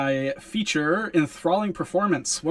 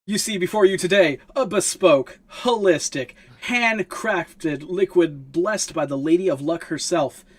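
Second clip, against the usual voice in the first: raised, emphatic showman's sales patter; rabble-rousing pitch; 3 semitones higher, 5 dB louder than usual. Acted. Hawker presenting an enchanted flask to the crowd, rabble-rousing pitch. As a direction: hawk it grandly to a gathered crowd. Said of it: "about to do a performance to get everybody's attention".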